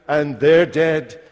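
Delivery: ordinary talk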